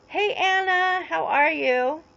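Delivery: high sing-song hello, drawn out slowly; cheery greeting. Real, not acted. Greeting a viewer in chat.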